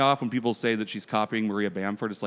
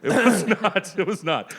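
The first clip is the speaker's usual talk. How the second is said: laughing; 9 semitones higher, 6 dB louder, slower than usual. Real, not acted. Laughing denial of intramural claim.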